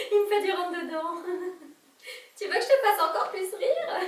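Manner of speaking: high giggly confession; laugh, verging on tears. Acted. Journalist telling of the doctor's advances, laughing.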